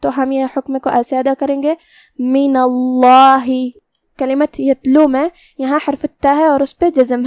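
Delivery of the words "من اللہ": slow recitation of من اللہ with a heavy, full lam in اللہ; heavy Allah. Shown as a demonstration. Teacher demonstrates tafkheem of Allah to students. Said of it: "بھاری آواز میں پڑھیں گے".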